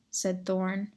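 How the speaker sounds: usual speaking voice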